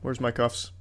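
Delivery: ordinary talk, nothing marked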